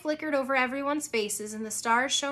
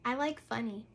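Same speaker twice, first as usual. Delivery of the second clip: shy; 9 dB quieter than usual. A narrator's reading. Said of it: "She blushed a little".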